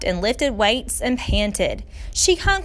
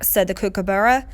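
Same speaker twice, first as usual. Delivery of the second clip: ordinary delivery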